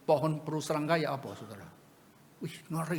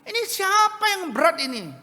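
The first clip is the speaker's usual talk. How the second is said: high, loud furious demand; father screaming at his wife over the scratched car; hysterical shout; 11 dB louder than usual. Retold or imitated. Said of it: "histeris dia bilang".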